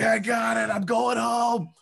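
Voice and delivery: high, loud victory cry; triumphant yell. Retold or imitated. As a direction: celebrate like you're finally free. Celebrates negative test running out.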